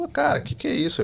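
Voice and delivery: a high astonished cry of disbelief; stunned disbelief. Real, not acted. Alone on the street, he reacts to God's message in high amazement. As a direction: jump up high in pure amazement.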